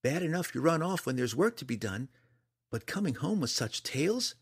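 high-pitched big-sister reproach; scolding big sister. A narrator's reading. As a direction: lecture your little brother. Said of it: "scolded in her best big sister voice".

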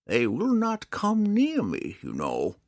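usual speaking voice

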